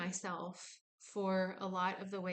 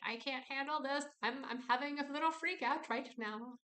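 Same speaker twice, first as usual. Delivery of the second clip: higher, mildly panicked voice; mock panic; 6 semitones higher than usual. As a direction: act a little freak out, lightly. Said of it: "her yelling".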